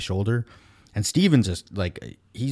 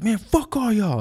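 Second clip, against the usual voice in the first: hammed-up drunk taunt, voice pushed up high; mock aggressive drunk; 14 semitones higher than usual. Retelling the rude ex-dishwasher's slow, high taunt at the bar.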